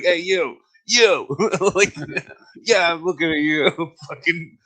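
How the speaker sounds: high, loud heckling with laughter; mock calling out. People confronting someone doing something stupid, mock calling out.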